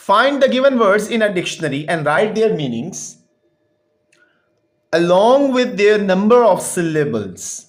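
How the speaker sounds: slow, deliberate textbook reading; slow read-aloud. Real, not acted. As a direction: read it out word by word for a class. (ٹھہر ٹھہر کر سبق پڑھنا)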